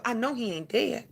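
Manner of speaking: hushed, stunned denial; a soft, stubborn refusal to believe; quiet denial. Retold or imitated. Telling his roommate he can't be dead, quietly insisting. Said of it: "the first thing I said afterwards was".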